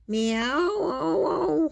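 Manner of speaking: long, high, wavering meow; very hungry meow. A narrator's reading. Kitty saying she is very hungry, drawn-out meow. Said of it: "I am very hungry".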